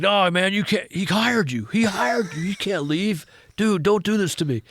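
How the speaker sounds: high, urgent retold plea; pleading protest. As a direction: beg him not to walk out. Retelling Jim's pleading objection.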